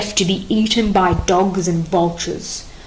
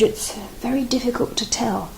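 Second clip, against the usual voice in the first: whispered, much softer; fearful whisper; 3 semitones higher, 8 dB quieter than usual. Acted. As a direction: hardly dare to say it. Frightened sentry hesitates.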